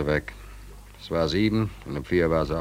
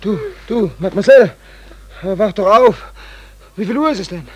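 urgent calling; 13 semitones higher, 7 dB louder than usual. Acted. Weckt die Dame, ruft.

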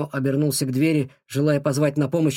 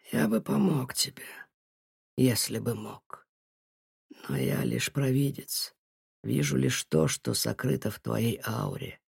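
put-on sinister old seer; 5 dB quieter than usual. A narrator's reading. Nuhim explains he is only a seer.

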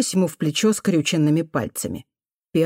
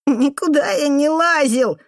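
put-on high, slow child's whine; sobbing denial; 9 semitones higher than usual. A narrator's reading. Boy denying to the janitor that he climbed, sobbing. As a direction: deny it tearfully, in a boy's voice. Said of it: "всхлипнул мальчишка".

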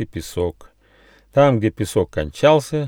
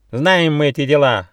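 higher, louder cross parrot; irritated, raised-voice retort; angry shout; 7 semitones higher, 5 dB louder than usual. A narrator's reading. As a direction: cry out crossly, like a scornful parrot. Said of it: "сердито крикнул попугай".

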